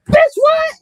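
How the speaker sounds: very high, loud sharp challenge; retold squaring up. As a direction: dare her to try it.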